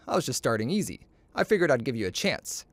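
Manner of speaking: plain reading voice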